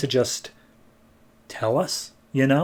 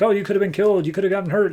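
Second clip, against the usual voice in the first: worried scolding; 7 semitones higher, much faster than usual. Retold or imitated. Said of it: "the cop starts yelling at his son".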